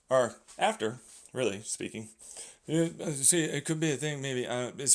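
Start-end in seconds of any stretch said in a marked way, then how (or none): none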